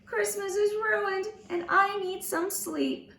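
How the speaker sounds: lightly played tearful mom; weeping. A narrator's reading. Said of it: "then Mom started to weep".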